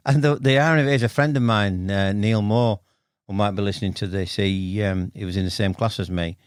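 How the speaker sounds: ordinary delivery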